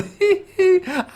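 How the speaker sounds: high mocking laugh. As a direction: laugh at him from above. Mocking the flying turtle.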